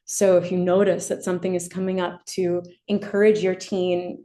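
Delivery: usual speaking voice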